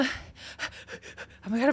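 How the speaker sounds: panting between words; breathless from running around; out of breath. Real, not acted. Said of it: "I'm out of breath".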